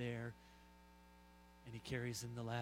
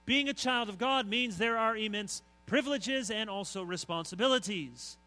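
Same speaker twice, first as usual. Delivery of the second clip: BEING preached high and loud, stretched; emphatic opening; 7 semitones higher, 10 dB louder than usual. Real, not acted. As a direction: lean hard on Being to open the point. Preacher stresses opening claim.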